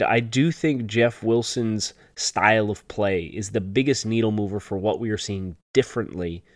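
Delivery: normal talking voice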